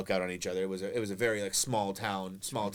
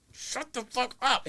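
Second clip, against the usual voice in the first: strained hush; 12 semitones higher, slower than usual. Retold or imitated. Hushing brother.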